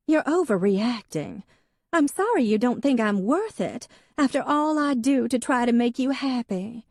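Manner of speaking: high, insistent grievance; Hadley's aggrieved voice. A narrator's reading. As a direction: accuse him like a resentful young wife. Wife voiced in novel.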